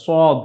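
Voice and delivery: heavy emphatic s in "সদ"; thick emphatic sad. Shown as a demonstration. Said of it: "একটু মোটা করে উচ্চারিত হয়". (একটু মোটা করে "সদ")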